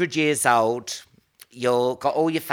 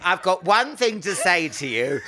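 ordinary talk

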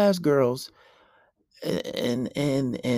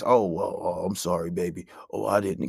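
low, soft mock apology; mock the meek boyfriend saying sorry; simp apology; 12 semitones lower, 5 dB quieter than usual. Mocking a meek boyfriend apologising to his girlfriend, soft and low. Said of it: "and you just".

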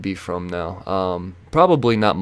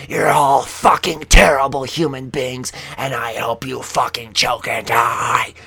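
slow, loud, higher angry scream; retold scream; 3 semitones higher, 5 dB louder, slower than usual. Screaming at bystanders from the car, slow angry yell. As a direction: curse them out of the car window. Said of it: "I scream".

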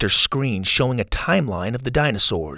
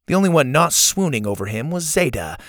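ordinary delivery, nothing marked

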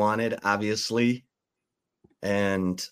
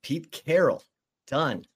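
loud, raised announcer call, stretching the repeat; breaking-news announcement; 4 semitones higher, 5 dB louder than usual. Real, not acted. Host interrupting with Pete Carroll news, loud announcement. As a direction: break in with urgent headline news.

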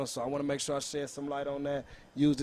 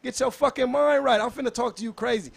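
loud, high trash talk; hyped shouting; 8 semitones higher, 10 dB louder than usual. Pretend. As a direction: yell it like a battle rapper calling out a rival.

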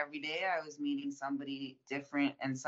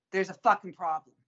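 louder, higher, hammering the point home; emphatic punchline; 5 semitones higher, 9 dB louder than usual. Real, not acted. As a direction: land it hard and loud. Punchline about dealers' concern.

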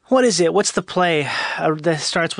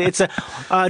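usual speaking voice

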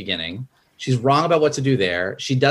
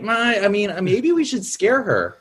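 high, slowed, hesitant voice; timid Sadness; 8 semitones higher than usual. Retold or imitated. Sadness suggesting they scare Riley, timid and high. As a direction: offer it meekly, pitched up. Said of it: "And Sadness is like".

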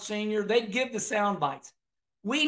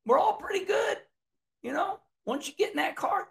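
lightly imitated driver, pitched up high; Kenny Schrader; 9 semitones higher than usual. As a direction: quote him in a higher voice. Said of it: "Like Kenny Schrader said".

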